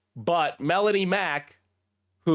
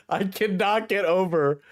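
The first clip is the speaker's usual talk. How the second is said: normal talking voice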